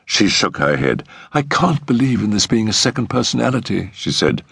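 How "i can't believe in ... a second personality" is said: put-on higher-pitched young woman's voice; earnest, higher protest; distressed fiancée. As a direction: protest as a troubled girl.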